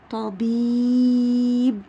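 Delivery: طبيب with a very long, loud ee before the final b; slowly stretched-out طبيب; elongated middle vowel. Shown as a demonstration. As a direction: hold the ee in طبيب, then close on b. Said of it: "صوته بيكون طويل".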